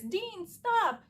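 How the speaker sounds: a light take on Rory calling Dean back; imitating Rory. Rory calling after Dean as he walks away, pleading call.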